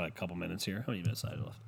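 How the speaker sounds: ordinary talk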